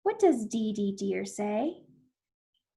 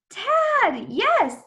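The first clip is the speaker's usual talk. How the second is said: high, loud, pleased cheer; excited confirmation; 10 semitones higher, 6 dB louder than usual. Pretend. Teacher confirms the child's word, excited cheer. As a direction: exclaim it like a right answer.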